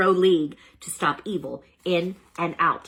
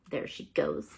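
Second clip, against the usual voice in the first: whispered and much lower; under breath; 14 semitones lower, 7 dB quieter than usual. A narrator's reading. Hamster whispers as the teacher shuts the door. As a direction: hush it to yourself. Turbo mutters as she leaves.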